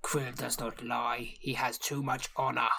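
slow, very quiet, solemn orc; orc chieftain. Acted. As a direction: declare your honour softly and gravely. Orc speaks of himself solemnly.

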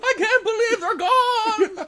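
high, slow mock funeral wail; wailing nonna. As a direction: wail it like a grieving old woman. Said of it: "The Italian nonna".

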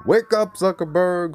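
loud, high mock wake-up call. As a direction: holler to rouse someone far off. Calling out to an absent boss.

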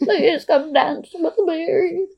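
high, slow, laughing impression; badly faked old-lady voice, drawn out; quavery old-woman Drusilla. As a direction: plead in a hammed-up high old voice. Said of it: "doesn't really sound like an old woman".

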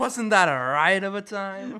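loud, slow, high mock guffaw; hammed-up hilarity. Joining the clincher scene parody, hammed-up hilarity. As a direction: boom it out while laughing, drawn out. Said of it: "they're all talking like".

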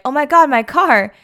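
high, loud shocked cry over the car; wife shocked at her car; dismayed. Retold or imitated. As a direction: gasp it out in mock horror. Wife sees her wrecked car.

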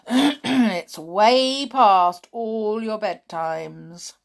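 slow, gentle porter voice; a slow, kindly old porter. A narrator's reading. Porter's voice in story.

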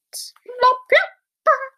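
high-pitched little plops; imitated plop. Stone drops on sleeping giant, high plopping sound. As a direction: pop out each plop up high.

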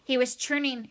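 plain reading voice

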